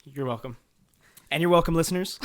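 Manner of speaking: usual speaking voice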